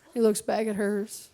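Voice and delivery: usual speaking voice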